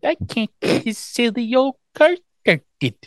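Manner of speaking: put-on high, slow little-girl baby voice; put-on helpless baby voice; mock baby talk. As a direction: imitate cutesy baby talk, drawn out and high. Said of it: "she's baby talking".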